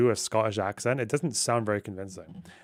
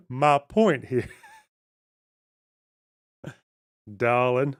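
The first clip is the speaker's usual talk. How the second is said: amused attempt at an American accent; playfully put-on American accent; slower than usual. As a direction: try an American accent, breaking into laughs. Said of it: "if I wanna learn an American accent".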